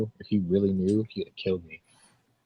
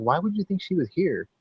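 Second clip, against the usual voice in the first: high, protesting question; feigned innocence; 11 semitones higher than usual. Retold or imitated. Retelling his denial to the girlfriend's dad, innocent high-pitched protest. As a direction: act baffled by the accusation, pitched up.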